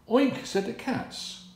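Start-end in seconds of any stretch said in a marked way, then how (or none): 0.1-0.4 squealed piggy oink, higher and louder. Acted. Cats faking a pig for the thieves, squealing oink.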